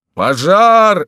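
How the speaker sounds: very loud, high, drawn-out roar; roared alarm. A narrator's reading. Distant townsfolk joining the panic, roaring. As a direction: bellow it to carry far. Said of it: "заревели те".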